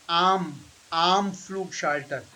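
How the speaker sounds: am with an open ah, like "ahm"; slow model of am Flugschalter, ah held; German A in am. Shown as a demonstration. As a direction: open up the vowel in am. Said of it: "it is not am".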